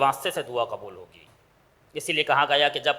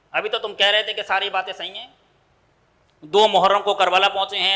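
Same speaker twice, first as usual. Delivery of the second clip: ordinary delivery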